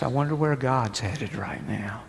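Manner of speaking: whispered, almost unvoiced. Preacher wondering aloud to the congregation, whispered. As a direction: breathe the question like a secret.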